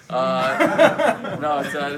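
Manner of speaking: bursting into laughter; laughing mid-sentence. Real, not acted. Laughs at his own answer.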